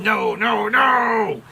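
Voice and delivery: high, loud cry of "no"; retold scream. Chaplain reacting to flat earthers quoting the Bible, screaming. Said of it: "I would scream".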